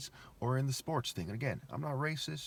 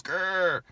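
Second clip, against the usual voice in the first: loud, higher mock cat growl; 6 semitones higher, 6 dB louder than usual. Explaining moral intensity to viewers, a playful cat growl. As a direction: growl like an angry little cat. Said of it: "if a cat gets mad and goes".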